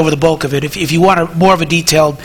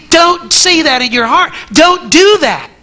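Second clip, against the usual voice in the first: higher, insistent rebuke; raised warning; 8 semitones higher than usual. Retold or imitated. Paul telling hearts to stop, in a higher, insistent voice. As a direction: stop them firmly, pitched up. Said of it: "Paul is saying".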